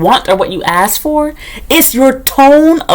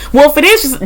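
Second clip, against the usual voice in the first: usual speaking voice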